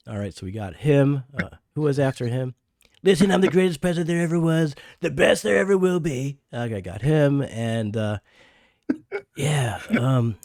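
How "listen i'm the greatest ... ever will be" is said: loud, higher boastful president voice; Donald Trump. Retold or imitated. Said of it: "your Trump imitation is really, really good".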